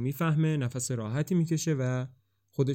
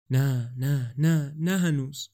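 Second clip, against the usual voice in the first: frightened, drawn-out pleading no; clinging on, a little scared, slowing down; a touch of panic in the no; terrified refusal; slower than usual. A narrator's reading. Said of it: "وحشت زده".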